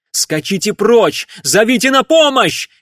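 loud, high-pitched cry of command; urgent shout. Acted. Rider shouts to Angelique to flee and get help, urgent yell. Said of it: "крикнул он ей".

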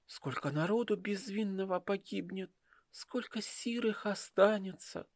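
put-on high, soft girl's voice, full of dread; a frightened young woman pleading. Dasha's voice in the story. (испуганный девичий голос)

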